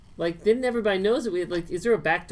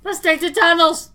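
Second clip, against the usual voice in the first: loud whiny plea; 11 semitones higher, 12 dB louder, slower than usual. Acted. Repeats tunnel plea loudly.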